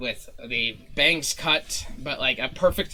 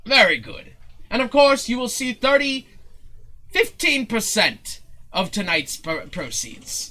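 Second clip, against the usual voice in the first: raised, theatrical deal-making voice; pompous showman; 10 semitones higher, 5 dB louder than usual. Acted. Showman promising a share of proceeds, pompous. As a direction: announce the terms grandly. Zin announces the fee.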